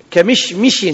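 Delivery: كمشمش said kemishmish, both m's with i; كمشمش with a clear i after each m; kasra on both mims. Shown as a demonstration. Teacher stressing the vowels of كمشمش for students. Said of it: "بكسر ميمه".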